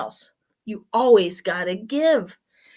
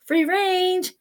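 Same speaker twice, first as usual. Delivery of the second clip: "Free range." pitched way up and drawn out; playful high call of "Free range."; high sing-song free range; 8 semitones higher, 3 dB quieter than usual. Shown as a demonstration. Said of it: "so free range was going in the neighborhood".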